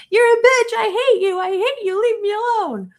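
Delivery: high, loud mock screaming of a furious ten-year-old; retold child screaming. A daughter screaming at her recording mom, high loud mock yell. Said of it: "her daughter's screaming".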